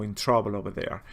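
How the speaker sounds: normal talking voice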